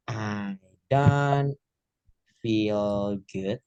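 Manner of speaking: drawn-out words with pauses between; word-by-word model. Shown as a demonstration. Teacher giving learners a correct phrase, word by word.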